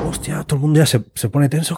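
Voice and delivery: ordinary delivery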